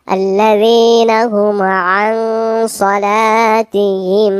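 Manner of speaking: slow melodic tajweed chant; Quran recitation. Acted. Teacher recites verses for students, slow melodic chant. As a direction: draw the vowels out in a chant.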